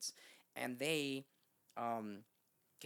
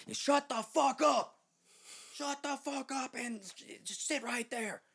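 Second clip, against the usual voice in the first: high, slow, full-volume shout; retold furious driver's roar; angry yelling; 14 semitones higher, 8 dB louder, slower than usual. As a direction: yell each word drawn out at a panicking passenger. Driver snaps at panicking passenger.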